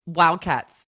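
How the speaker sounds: loud mock team cheer. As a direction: call out the team name with force. Naming team, then hushed echo.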